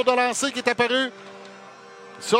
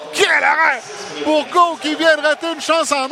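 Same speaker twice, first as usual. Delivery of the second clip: excited sports commentary; 3 semitones higher, 5 dB louder than usual. Real, not acted. Radio play-by-play, big save.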